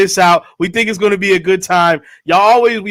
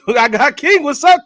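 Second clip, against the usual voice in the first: ordinary talk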